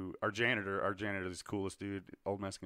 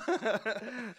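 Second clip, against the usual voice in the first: high laugh after the punchline; high-pitched amused laughter; 13 semitones higher, 4 dB louder than usual. Real, not acted. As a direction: crack up at your own bit. Laughs at own screamed punchline.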